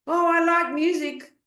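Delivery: high, loud mock enthusiasm; naive music lover. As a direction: gush it like a clueless beginner. Mimics a naive would-be musician.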